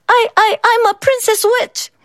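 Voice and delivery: put-on high, timid, stammering girl; soft squeaky voice. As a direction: squeak it out, scared and small. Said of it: "Megan's voice was soft and squeaky".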